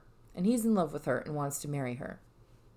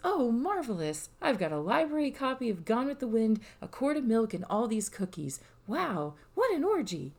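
sweet sparkle; 6 semitones higher than usual. Acted. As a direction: gush sweetly over simple treats. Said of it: "I prefer a sweet sparkle version".

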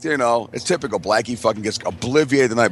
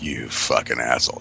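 low, disgusted mutter; quietly scornful, pitched well down; disgusted girlfriend; 11 semitones lower, 4 dB quieter than usual. Retold or imitated. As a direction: seethe it low and under the voice. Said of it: "looking at him like".

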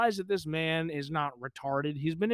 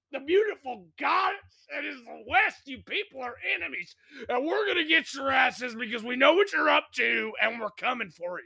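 a hammed-up zealot preacher, much higher; mock conspiracy rant; 9 semitones higher than usual. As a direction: rant like a street-corner doomsayer. Mock paranoid rant at Satanists.

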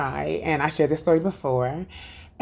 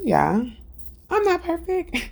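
ordinary talk

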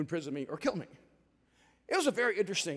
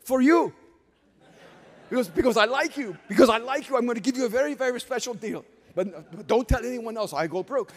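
merchant sales pitch; 4 semitones higher, 7 dB louder than usual. Retold or imitated. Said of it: "chases me down and goes".

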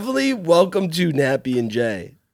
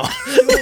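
quick high giggle; high laugh. Real, not acted. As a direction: laugh along. Host laughs too.

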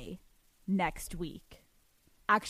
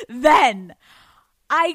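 a loud, punched then; louder restart; 3 semitones higher, 11 dB louder than usual. Acted. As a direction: kick the story off again, louder.

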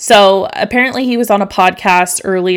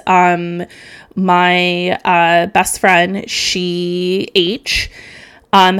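normal talking voice

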